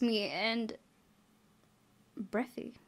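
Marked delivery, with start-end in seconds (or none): 2.2-2.8 airy whispered word; breathy whisper. Shown as a demonstration. Player breathing the word she just read.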